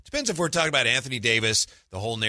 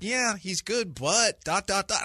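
hedging; 8 semitones higher, slower than usual. Acted. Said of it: "leaves them with a taste of".

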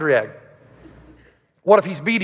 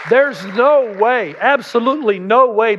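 slow emphasis; 3 semitones higher, slower than usual. Real, not acted. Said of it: "preached emphasis".

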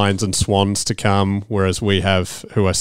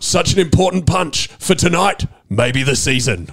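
lightly voiced TV commentator, slower; commentator call; 6 semitones higher than usual. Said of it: "commentated it perfectly".

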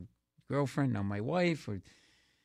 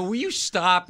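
louder, higher fed-up retort; mock exasperation; 8 semitones higher, 8 dB louder, slower than usual. Comic replying to supposed prank caller, mock exasperation. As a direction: snap at a prank caller, hammed up.

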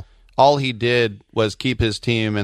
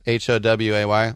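ordinary delivery

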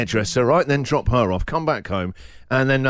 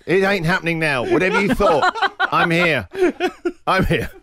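gloating; 7 semitones higher than usual. Retold or imitated. Triumphant gloat at daughter.

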